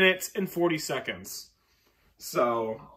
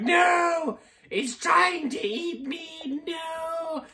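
mock panicked hamster; 13 semitones higher, much slower than usual. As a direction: squeal like a terrified hamster. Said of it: "and be like".

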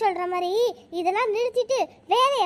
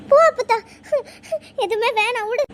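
upset outburst, loud then sobbing; tearful refusal; 3 semitones higher, 6 dB louder than usual. Acted. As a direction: push grandmother away through tears. Crying girl rejects grandmother's comfort.

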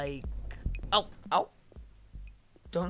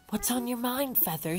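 soft, higher imitation of a villager; mock villager Celia; 7 semitones higher, 4 dB quieter than usual. Player imitates Celia's chat, soft higher voice. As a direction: lift the pitch and keep it small. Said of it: "Like, who talks like that?".

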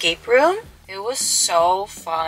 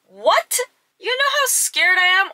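much higher, louder, alarmed; panicked disbelief; 13 semitones higher, 5 dB louder than usual. Retold or imitated. Telling boyfriend she's scared, high panicked protest. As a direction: protest in mock panic, pitch flying up. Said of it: "And I'm like".

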